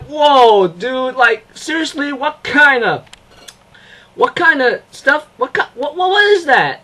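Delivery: high-pitched, stumbling amazement; stunned reaction. Retold or imitated. Fan reacting to a surprise opening, stunned. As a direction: act blown away, tripping over the question.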